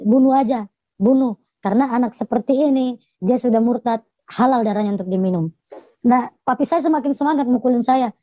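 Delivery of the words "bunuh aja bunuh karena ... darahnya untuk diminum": ordinary delivery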